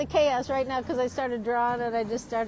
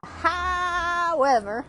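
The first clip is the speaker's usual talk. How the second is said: high, held first syllable; drawn-out segue; 7 semitones higher, 4 dB louder, much slower than usual. Acted. Pulling out of a cartoon tangent, long high drawl. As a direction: stretch the word right out. Breaks off the rooster impression.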